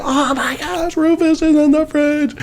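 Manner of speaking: high, wailing imitation of a horrified woman; mock horror. Imitating Megan telling Dan about the cat, mock horror.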